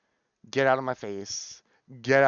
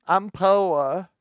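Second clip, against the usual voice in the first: normal talking voice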